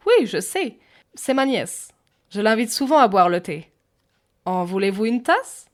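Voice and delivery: put-on high, loud old English lady; English accent. A narrator's reading. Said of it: "dans un accent anglais".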